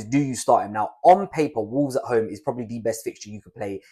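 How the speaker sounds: ordinary talk, nothing marked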